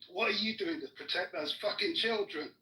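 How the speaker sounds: normal talking voice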